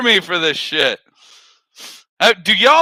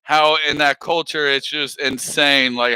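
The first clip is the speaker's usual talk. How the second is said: normal talking voice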